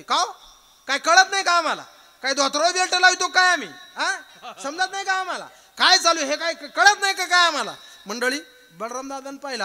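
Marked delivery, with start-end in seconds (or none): 0.0-7.7 high, loud indignant tirade; angry confrontation. Acted. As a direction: hurl offended rhetorical questions one after another. Acted quarrel, Duryodhana confronts brother.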